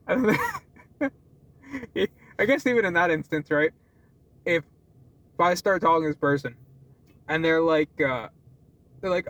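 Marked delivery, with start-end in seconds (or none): none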